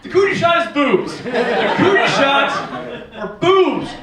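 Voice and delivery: loud, high, drawn-out outrage; hammed-up, slow-building astonishment; shocked realization. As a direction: shout it as an appalled discovery.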